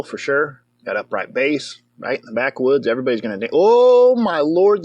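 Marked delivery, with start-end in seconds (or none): 3.5-4.8 amazed. Real, not acted. Watching the fiddle dance scene.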